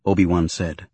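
ordinary delivery

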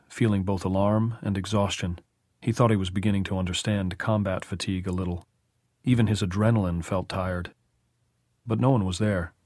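ordinary delivery

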